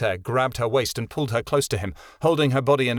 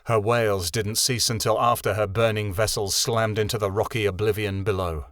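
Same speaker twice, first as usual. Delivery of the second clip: ordinary delivery, nothing marked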